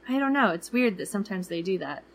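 ordinary delivery

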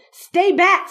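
sharp warning. Acted. Father warning child away from fight.

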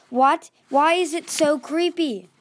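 loud, high, alarmed questions; startled yelping. A narrator's reading. Clay alarmed by the creepy hole, startled yelping. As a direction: yelp the questions, drawing out the last one. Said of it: "he yelped".

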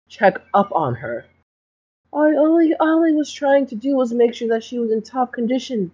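a smooth talking school doctor feigning innocence; smarmy doctor. Acted. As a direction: play the doctor as falsely sweet and wounded. GM holds doctor voice.

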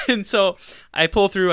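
ordinary talk, nothing marked

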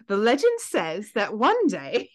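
put-on British storyteller accent; playful British accent, swooping tune. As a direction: narrate the legend in an amateur British accent. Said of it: "do this whole thing in an accent".

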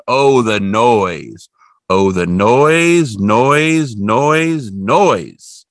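slow, loud, drawn-out noise; repeating the noise with mounting annoyance; exasperated. A narrator's reading. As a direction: lean on each noise with disgust. Narrator dramatizes the noise.

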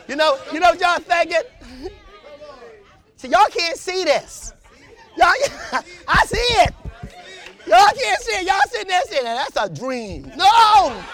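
high, loud laughing exhortation; joyful preaching cry; laughing preaching shout. Acted. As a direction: whoop it out to the congregation.

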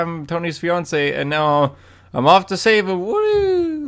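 cheesy mock heroine, ending in a high whoop; hammed-up, cheesy heroic send-off; Pepper Potts. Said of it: "that's a little cheesy".

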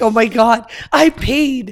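lightly voiced panicked friend; quoting friend. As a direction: mimic her alarmed cry. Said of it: "she's like".

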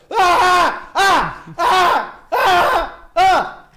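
repeated loud, high nightmare screams; string of short hammed-up terrified yells; re-enacted terror scream. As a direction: scream in bursts, as if waking from a nightmare. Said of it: "me he despertado gritando".

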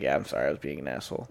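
low, soft, fast sheepish mumble; the hungover friend mumbling an apology the next day. Retold or imitated. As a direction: rush a soft embarrassed apology.

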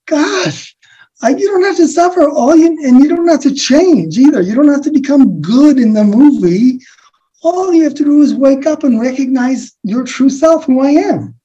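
pleading through real tears to wake them; tearful compassion. Real, not acted. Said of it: "I'm going".

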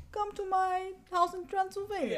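a high, hammed-up Transylvanian accent; bad Transylvanian accent. Dracula inviting Jonathan to his house, in a hammed-up Transylvanian accent. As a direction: play the count inviting his guest home in a hokey Transylvanian accent. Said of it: "that was a bad accent".

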